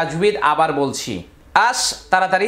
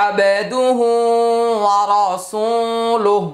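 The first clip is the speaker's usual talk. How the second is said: whole phrase chanted slowly in a melodic recitation voice; 2 semitones higher, much slower than usual. Shown as a demonstration. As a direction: recite the tashahhud slowly like Quran reading. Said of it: "এবার আমি উচ্চারণ করে দিচ্ছি". (ধীরে সুর করে তাশাহুদ পড়া)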